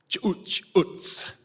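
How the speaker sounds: Chuch utz cooed high and breathy; cooing at a baby. Retold or imitated. Priest retelling how he praised village babies, cooing high. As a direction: coo it at a baby in its mother's arms. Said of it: "use the words I know".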